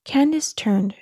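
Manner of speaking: usual speaking voice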